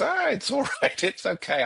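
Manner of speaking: high, laughing through the words; laughing reassurance. Retold or imitated. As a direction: giggle it out as if it's fine. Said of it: "And she goes".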